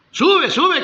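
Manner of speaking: high, loud urging shout; eager shout. Acted. False prophets urging the king to war, loud. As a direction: push him on with loud cries. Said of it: "los cuatrocientos que le decían".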